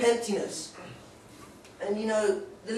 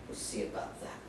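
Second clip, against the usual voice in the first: sinister whisper; 13 dB quieter than usual. Acted. Menacing aside after therapist.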